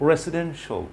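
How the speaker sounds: "রেসিডেন্সিয়াল" ending in a short "sho"; correct pronunciation. Shown as a demonstration. Teacher models the word for learners, correct pronunciation. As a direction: say "রেসিডেন্সিয়াল" as "resi-DEN-sho". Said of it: "pronunciation শো".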